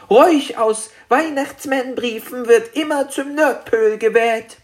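high, firm dragon voice; entschlossener kleiner Drache. A narrator's reading. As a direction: rule it out firmly as a little dragon. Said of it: "sagte Spekulatius entschlossen". (hohe, entschlossene Drachenstimme)

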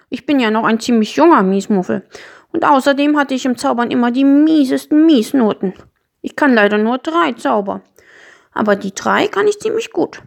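a touch sheepish, then hopeful; lightly voiced young Miesmuffel, sheepish. Young Miesmuffel admits his few spells, mildly sheepish.

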